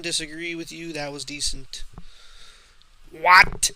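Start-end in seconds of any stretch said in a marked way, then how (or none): 3.1-3.7 loud, high "What?" of disbelief; shocked shout. Real, not acted. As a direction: yell it in utter disbelief. Opponent wins by a huge margin.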